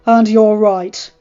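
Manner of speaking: loud, hard-edged lord berating his wife; furious husband. Acted. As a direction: push every line at her in anger. Ned's angry speech to wife.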